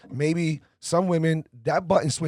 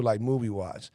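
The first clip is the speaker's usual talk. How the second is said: ordinary talk, nothing marked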